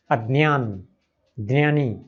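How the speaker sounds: अज्ञान and ज्ञानी said with dnya, d then n then y; Marathi dnya pronunciation. Shown as a demonstration. Teacher imitating how Marathi speakers say these words. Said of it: "द न य ... की तरह बोलते हैं".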